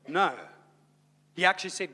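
ordinary talk

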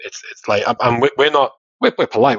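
ordinary delivery

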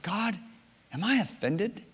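soft, high, questioning; tentative prayer. Retold or imitated. Man asking God in his backyard, tentative. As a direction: ask it softly upward, unsure.

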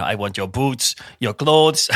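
deadpan, clipped mock Austrian action-hero accent; bad Terminator accent. Imitating a person acting like the Terminator, awful Austrian accent. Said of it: "That's an awful accent".

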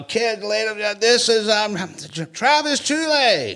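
mock announcer. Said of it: "all the kids are screaming".

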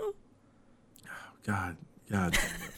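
unvoiced, laughing curse; laughing whisper. Real, not acted. Reaction to the joke.

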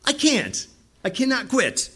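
high-pitched, helpless protest; pleading refusal. Retold or imitated. Preacher telling how he cannot quit, high protest.

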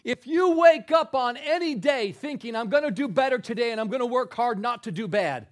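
loud, high preaching at full force; fiery preaching. Acted. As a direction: preach it out to the crowd.

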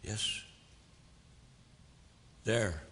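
normal talking voice

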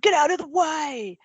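mock yell from a passing cyclist; retold scream. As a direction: throw it like an angry rider yelling. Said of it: "He screamed".

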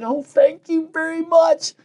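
high, slow, gushing gratitude; thrilled by a surprise gift; delighted thanks. Retold or imitated. Woman receives surprise gift basket.

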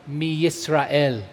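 slow, weighty "mi Yisrael"; emphasized word. Shown as a demonstration. Teacher reading the Hebrew to class, stressing "mi Yisrael" slowly. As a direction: lay deliberate weight on "mi Yisrael". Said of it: "I'll emphasize that word".

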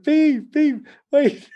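very high mimicked calls; high-pitched pleading calls, imitated; imitated distant yelling. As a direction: mimic girls' voices calling from behind. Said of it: "I could still hear their voices".

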